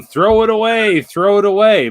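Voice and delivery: high, loud mock yell; imitated fan yelling. Diehard Bills fans yelling at the quarterback, imitated shouting. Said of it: "and they're yelling".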